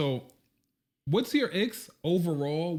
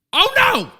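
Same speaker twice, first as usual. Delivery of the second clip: very high, loud oh no; loud outburst; 15 dB louder than usual. Real, not acted. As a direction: burst out in protest. Outraged denial.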